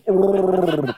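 imitated Wookiee growl; long rolling creature roar, done as a joke; Chewbacca roar. Dad mocks a co-pilot in the car, Chewbacca roar. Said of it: "like Chewbacca sitting in the car like".

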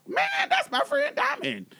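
very high mock protest; high, laughing retold objection; Storm. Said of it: "Storm gets up and say".

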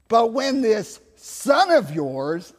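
loud, slow, pointed accusation; disowning resentment. Acted. Elder son disowning his brother to his father, loudly resentful. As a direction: throw THIS SON OF YOURS out high and drawn out. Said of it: "I always love it, not, not your".

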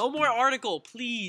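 loud, high-pitched protest; real pleading objection; exasperated plea. Real, not acted. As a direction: beg them to drop it, loudly. Pleading to stop the article.

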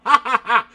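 high, loud burst of laughter. Real, not acted. Laughing at a chat question.

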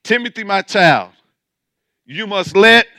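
loud verse reading. Shown as a demonstration. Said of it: "My verse says".

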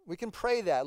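ordinary talk, nothing marked

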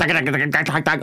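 rapid-fire "dak" syllables; imitated rattling noise. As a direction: rattle it off like a machine. Imitates rattling through the set.

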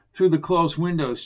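ordinary delivery

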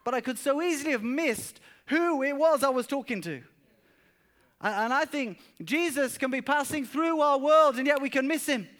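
high, loud, impassioned preaching; preaching at full force. Acted. Pastor urging the church not to miss Jesus, full-force preaching. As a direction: drive the point home to the congregation.

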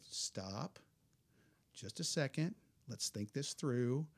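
soft, slow, steady reassurance; calm father. Retold or imitated. Dad on the shore calming his son, soft and slow. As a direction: settle a panicking kid down gently. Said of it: "talked us through it in a real calm voice".

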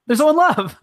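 hammed-up swoon at the lovers; swooning gush. Shown as a demonstration. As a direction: gush it like a starry-eyed fan. Gushing over the couple.

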